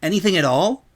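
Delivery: a hint of a worn-out tomboy asking; Rainbow Dash voice. Rainbow asking the princess if it will help, lightly voiced.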